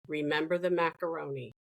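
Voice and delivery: 1 gentle reminder. Retold or imitated. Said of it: "turn and say to me".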